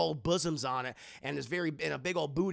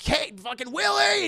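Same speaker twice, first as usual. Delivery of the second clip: much higher, louder yell; angry shout; 10 semitones higher, 7 dB louder than usual. Acted. Indy shouting at Willie to hurry.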